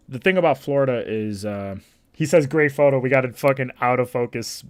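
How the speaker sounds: loud, high, heated rant. Real, not acted. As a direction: rant about it loudly. Ranting about the photo.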